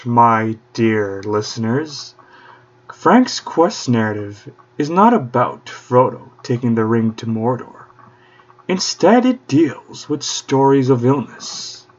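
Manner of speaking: usual speaking voice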